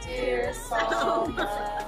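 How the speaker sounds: ordinary talk, nothing marked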